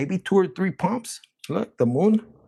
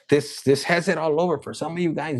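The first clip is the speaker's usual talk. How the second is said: ordinary talk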